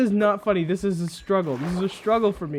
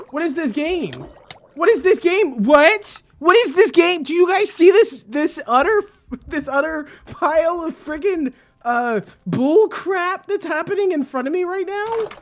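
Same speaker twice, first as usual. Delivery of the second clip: exasperated rant; 7 semitones higher, 4 dB louder than usual. Real, not acted. Ranting at game.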